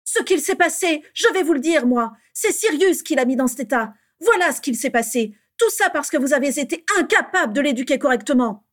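high, loud, accusing rant; furious tirade. A narrator's reading. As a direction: explode and lay the blame on them. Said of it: "explosa Bellatrix". (tirade furieuse et accusatrice)

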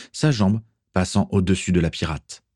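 ordinary delivery, nothing marked

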